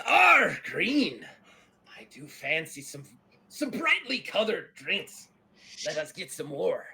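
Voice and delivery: loud "Arr", then relishing pirate talk; pirate Eddie Teach. Acted. Pirate admiring a green drink, hearty. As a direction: play a pirate fancying a drink.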